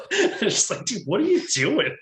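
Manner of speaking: high-pitched, laughing incredulity; laughing through an imitated reaction; laughing mock disbelief. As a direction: retell it while cracking up.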